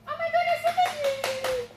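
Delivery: loud, very high gushing squeal; over-the-top high puppy praise; excited baby talk. Shown as a demonstration. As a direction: gush at the dog, stretching the ending. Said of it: "got a little more excited".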